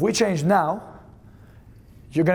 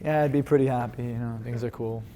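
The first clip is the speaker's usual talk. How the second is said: low, soft, indifferent answer; unenthused; 5 semitones lower, 5 dB quieter than usual. Acted. Coach imitating a partner doing it wrong, unenthused. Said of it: "they're not doing it right".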